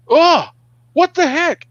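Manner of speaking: high, loud startled cry; man spotting bugs on his hands; retold shock. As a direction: jolt as he sees his hands.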